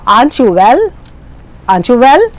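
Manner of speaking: WELL sweeps upward at the end; rising tag question. Shown as a demonstration. Teacher modelling a question for learners, rising on WELL. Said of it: "The rising intonation".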